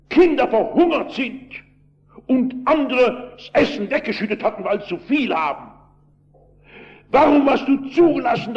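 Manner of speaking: high, forceful retold shouting of each warum. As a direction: hurl every warum at God, high and hard. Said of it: "so schrie der Mann".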